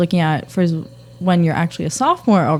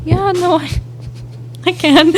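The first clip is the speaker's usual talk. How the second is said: laughing; 11 semitones higher than usual. Retold or imitated. Self replying, laughing.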